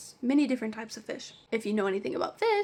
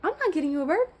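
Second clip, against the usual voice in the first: high, rapid-fire indignant rant; outraged retort; 4 semitones higher, faster than usual. Retold or imitated. Pet store worker venting the retort meant for a customer, outraged. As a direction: fire it off fast in exasperated disbelief.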